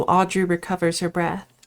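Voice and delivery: normal talking voice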